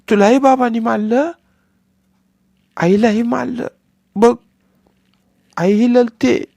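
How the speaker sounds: put-on high, lisping little boy tugging at his brother; begging childish whine; little boy coaxing his crying brother; pleading small child.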